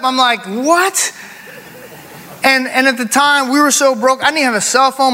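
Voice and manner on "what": high, rising astonished question; re-enacted teary surprise; teary disbelief. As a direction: act out being moved and stunned by good news. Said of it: "I start getting teared up".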